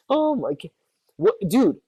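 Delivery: ordinary delivery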